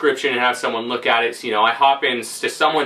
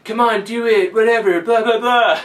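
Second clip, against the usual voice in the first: mock taunting girlfriend; 10 semitones higher, 4 dB louder than usual. Girlfriend taunting him to shoot.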